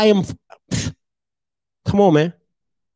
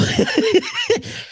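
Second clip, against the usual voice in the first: high laugh; 14 semitones higher, 4 dB quieter than usual. Real, not acted. Laughing at own joke.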